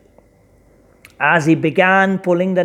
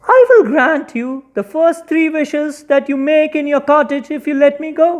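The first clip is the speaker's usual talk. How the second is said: lightly raised fish voice; a touch of fish voice; 7 semitones higher than usual. Acted. Fish bargains for its freedom, lightly higher voice. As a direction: offer the wishes a little higher. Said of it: "said the fish".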